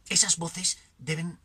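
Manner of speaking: ordinary talk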